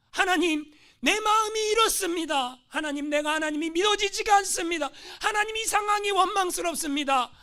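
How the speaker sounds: high, loud pleading prayer; anguished prayer. Retold or imitated. Imprisoned pastor pleading with God in anguish.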